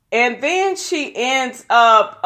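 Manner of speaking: ordinary delivery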